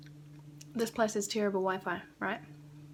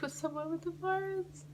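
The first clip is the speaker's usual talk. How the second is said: panicky disbelief; 10 semitones higher than usual. Retold or imitated. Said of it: "I was like".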